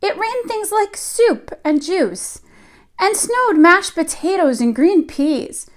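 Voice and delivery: put-on lively read-aloud voice for young children; storybook reading. A narrator's reading. Teacher reading a picture book to her class, lively storytelling. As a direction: tell the tale to the class as if they sit on the rug.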